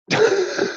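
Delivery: short high-pitched laugh at himself. Real, not acted. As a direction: burst into a quick amused laugh. Laughs at his own attempt.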